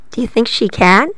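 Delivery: ordinary delivery, nothing marked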